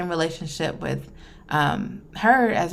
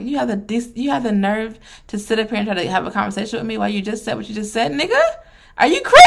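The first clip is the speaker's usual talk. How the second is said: outraged rant; 4 semitones higher, faster than usual. Retold or imitated. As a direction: build from heated to shrieking at the father. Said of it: "I'm like".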